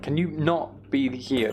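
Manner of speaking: usual speaking voice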